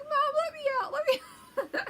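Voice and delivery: very high, loud mock wail; hammed-up squealing protest; terrified little sister. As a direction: imitate a panicking little kid. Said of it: "my poor little sister Mimi is terrified".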